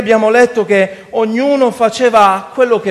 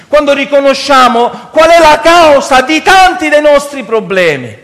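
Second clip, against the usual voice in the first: impassioned, raised-pitch sermon; preaching; 7 semitones higher, 7 dB louder than usual. Real, not acted. Preacher asking brothers why they wait to cry out, preaching. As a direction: challenge the congregation with full force.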